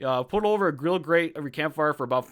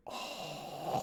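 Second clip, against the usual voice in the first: soft, long breathy gargling moan; drawn-out slobbering groan of hunger; Homer Simpson hungry drool; 14 dB quieter than usual. Acted. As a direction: drool aloud like a cartoon glutton. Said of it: "That's the Homer Simpson hungry".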